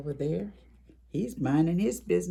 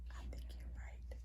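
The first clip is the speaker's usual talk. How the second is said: soft secret whisper; a whispered confirmation to the kids; 20 dB quieter than usual. Acted. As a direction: agree in a hush, like sharing a secret. Hushed reply to the children.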